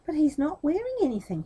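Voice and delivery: put-on high, loud child's call; child's cry. A little child calling out to the crowd, high. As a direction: blurt it out like a child. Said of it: "he cried".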